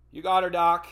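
a loud, happy shout-out; loud cheerful call. Retold or imitated. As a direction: call it out loud and chipper to the doctor. Anesthesia banter before going under.

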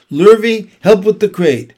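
loud, high call to a helper; shouted order. A narrator's reading. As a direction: shout for help as you hurry. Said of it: "shouted Mister Zuckerman".

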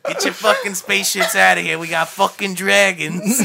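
hammed-up Boston bragging; Boston accent, an alien boasting of dragons. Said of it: "they're all talking in a Boston accent".

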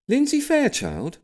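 high, slow, prim lady's query; area manager asking. A narrator's reading. Avon manager at the door checking the name, prim and high. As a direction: ask it primly, drawing it out high. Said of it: "she asked".

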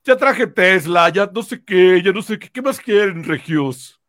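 loud, slow bragging announcement; boastful politician. Retold or imitated. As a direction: show off to the crowd, loud and slow. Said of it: "el fin de semana que puso".

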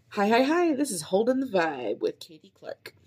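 high bouncy greeting into show name; upbeat podcast intro. Shown as a demonstration. Podcaster rehearsing her show intro, upbeat host voice. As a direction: sell it like a cheerful host.

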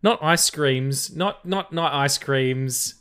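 playful scolding; mock indignation. Real, not acted. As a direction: drag it out in mock offense. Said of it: "I know".

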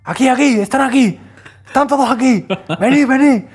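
high loud calling shout; mock shout. The cornered kid in the legend shouting for fake friends, hammed-up yell. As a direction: yell for backup that isn't there. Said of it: "empezó a gritar hacia el otro lado".

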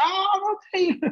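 hammed-up fangirl shriek at the star; imitating a starstruck fan. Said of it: "the girl who worked there went mental".

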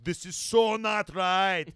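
put-on high loud valley girl whine; over-the-top valley girl panic. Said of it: "to sound like a valley girl".

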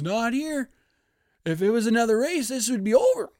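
hammed-up race commentary; commentator Clint Boyer. Imitating the TV commentator calling it, high and loud. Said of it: "Clint Boyer goes".

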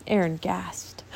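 ordinary delivery, nothing marked

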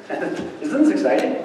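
whispered wry aside with a laugh; mock excitement. Real, not acted. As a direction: tease the crowd under your breath. Joking aside about a rock.

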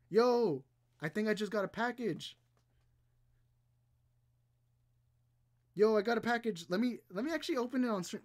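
loud, high, thrilled "yo" energy; real excitement. Real, not acted. Streamer telling viewers a package just arrived, excited.

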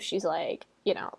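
usual speaking voice

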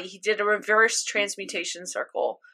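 normal talking voice

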